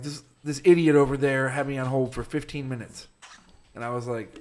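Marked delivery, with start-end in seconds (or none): 0.0-3.1 louder, annoyed complaint; mock irate customer ranting; imitating an irate caller; angry caller. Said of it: "he's like".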